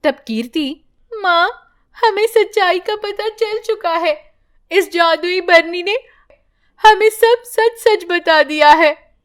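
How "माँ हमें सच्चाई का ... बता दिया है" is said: put-on much higher young girl's voice; girl confessing to mother; earnest daughter. Daughter tells mother the truth.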